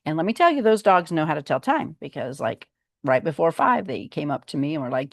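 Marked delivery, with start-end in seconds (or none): none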